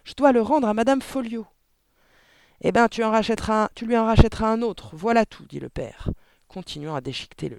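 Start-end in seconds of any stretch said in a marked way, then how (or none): 0.0-1.5 high, loud, urgent protest; crying out. A narrator's reading. Daughter explaining it's a library book, shouting. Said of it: "cria Mathilda". 2.6-5.5 raised, loud, dismissive retort; angry father. A narrator's reading. Father tearing the book, telling daughter to replace it, angry.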